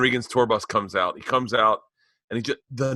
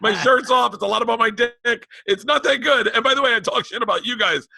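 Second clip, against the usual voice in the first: loud, high-pitched comic rant; mock-frantic high voice; hammed-up confession; 10 semitones higher, 3 dB louder than usual. Comic confession to his kids, retold.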